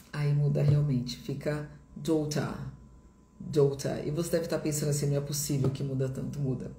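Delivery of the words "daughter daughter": British "daughter", crisp T, no R; sotaque britânico. Shown as a demonstration. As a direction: say "daughter" as "daw-tah", British. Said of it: "Em inglês britânico". (sotaque britânico, T claro, sem R)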